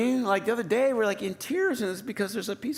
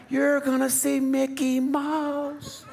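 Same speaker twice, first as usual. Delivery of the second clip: put-on higher, slow, drawn-out sales pitch to the kids; hyping the kids; 9 semitones higher, much slower than usual. Dad hypes up the trip to his sulking kids, mock-excited. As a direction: oversell the trip to cheer them up. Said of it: "you're trying to tell 'em".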